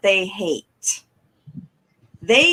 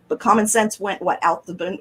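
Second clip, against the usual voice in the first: normal talking voice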